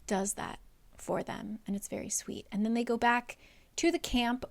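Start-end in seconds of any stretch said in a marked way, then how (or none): 1.6-2.5 much softer, unvoiced; soft aside. Real, not acted. Narrator softly calling it sweet. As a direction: say it tenderly under breath.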